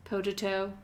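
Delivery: normal talking voice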